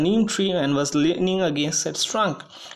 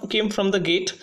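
ordinary talk

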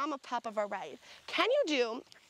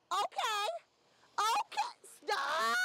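excited exclaim; 12 semitones higher, much slower than usual. Real, not acted. Shoot peaks, photographer exclaims.

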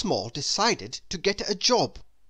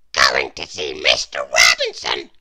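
tiny character voice, louder and airy; Mister Small's voice; 10 dB louder than usual. Acted. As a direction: voice the little man with a strained, airy tone. Mister Small answers the worm.

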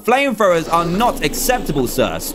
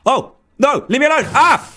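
panicked scream; 7 semitones higher, 3 dB louder than usual. Real, not acted. Attacked in the game, screaming.

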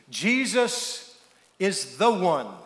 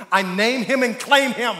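raised, punchy mock proclamation; mock faith claim; 4 semitones higher, 4 dB louder than usual. Girl claiming the first passing guy, hammed-up proclamation. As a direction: claim him like a hammed-up prayer. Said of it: "the first guy that comes walking along".

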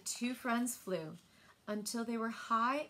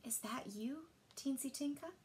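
hushed and much softer, almost breathless; soft whisper; 3 semitones higher, 7 dB quieter than usual. A narrator's reading. Mila softly greets Tinka sneaking back at night, hushed. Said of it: "and she whispered".

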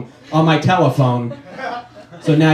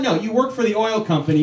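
usual speaking voice

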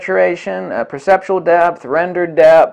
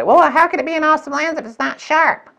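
much higher, put-on doubting voice; mock skeptic; 10 semitones higher than usual. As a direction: parody the doubter, pitched up. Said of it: "People will say".

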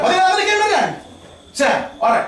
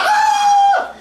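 high mocking shriek imitating worshippers; hammed-up cry of a frenzied worshipper; mock ecstatic shriek; 4 dB louder than usual.

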